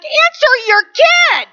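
high, drawn-out exasperated cry; stretched, high-pitched mock outburst; exasperated mock yell. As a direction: plead in hammed-up exasperation at an oblivious parent. Acted exasperated bystander to parent.

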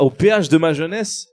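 ordinary talk

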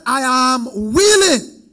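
proclaimed. Acted. Jesus voiced, proclaimed.

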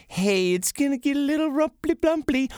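mock-sweet pilot announcement; sugarcoating captain. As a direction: chirp it like a captain hiding bad news. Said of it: "the captain to kinda sugarcoat it".

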